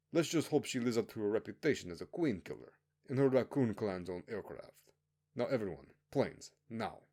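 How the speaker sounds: officer briefing convicts on their rigged planes, menacing; stern commanding officer. Acted. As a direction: lay down the rules like a cold officer. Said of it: "The commander then said".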